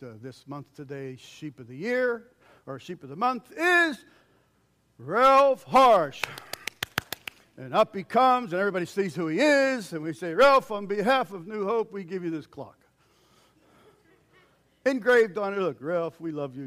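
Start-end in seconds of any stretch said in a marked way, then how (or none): 1.2-6.2 loud, high announcer voice; mock awards emcee calling the winner. 7.6-16.6 loud, high, eager storytelling; mock ceremony told at high volume; excited.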